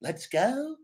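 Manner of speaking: high, soft, sing-song Let's go; silly character catchphrase. Shown as a demonstration. Wrestler showing a silly-character version, sing-song. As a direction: play it goofy. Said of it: "quirky, silly character".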